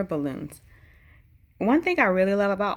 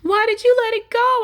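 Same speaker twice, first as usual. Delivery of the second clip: put-on much higher and louder, a child's anguished shout; angry yell; 10 dB louder than usual. A narrator's reading. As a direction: scream it at grandpa in despair. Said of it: "I yell".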